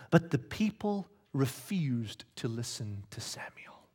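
slow hushed whisper; slow whispered scripture line. Acted. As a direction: breathe the line as a sad secret. Reading the sad turn quietly.

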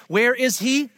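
ordinary delivery

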